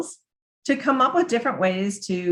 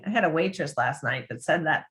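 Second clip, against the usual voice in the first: normal talking voice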